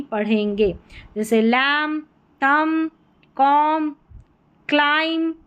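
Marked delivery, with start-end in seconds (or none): 1.4-5.3 लैम, तम, कॉम, क्लाइम ending on m, no b; silent B. Shown as a demonstration. Teacher modelling MB endings, silent-b demonstration. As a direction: separate held words लैम, तम, कॉम, क्लाइम closing on m. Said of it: "यहाँ पर बी silent रहेगा".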